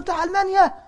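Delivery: high, loud gasp of recognition; shocked realization. Retold or imitated. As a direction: suddenly realize who you challenged. Boy realizes who the sheikh is. (الولد يندهش حين يعرف الشيخ بصوت عالٍ)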